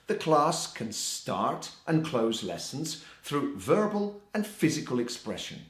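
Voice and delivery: ordinary talk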